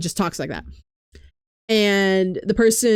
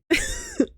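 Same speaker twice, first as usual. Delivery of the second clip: high burst of laughter; 6 dB quieter than usual. Real, not acted. Laughs about Estinian.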